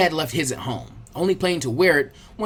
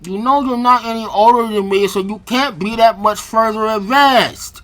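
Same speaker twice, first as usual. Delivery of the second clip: louder, mushy words through pinched cheeks; cheeks squeezed; 4 semitones higher, 6 dB louder than usual. Acted. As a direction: talk while your cheeks are pulled. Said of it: "voice came out distorted due to the girl's grabbing of his cheeks".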